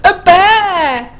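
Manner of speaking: high, loud storybook scream. A narrator's reading. Eddie coming face to face with a bear, screaming. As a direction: shriek it in alarm. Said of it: "screamed Eddie".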